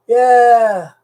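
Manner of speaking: loud, high drawn-out whoop; cheering yell. Acted. Cheering troops on to grab the equipment, rallying yell. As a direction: let out a big rallying yell. Cheers his own invasion idea.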